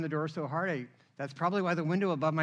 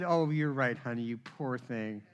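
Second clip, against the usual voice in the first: mock sympathy; 7 semitones lower, 5 dB quieter than usual. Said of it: "of course she said".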